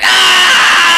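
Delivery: loud, long scream; drawn-out yell of fright; scream of panic. Real, not acted. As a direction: scream as the monster attacks. Attacked in game.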